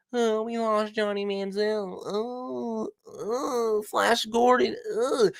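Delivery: high, slow whiny fake crying; mocking a coach who complains about his team; mock whining coach. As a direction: whimper like a sulking coach. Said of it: "Do you see me complaining".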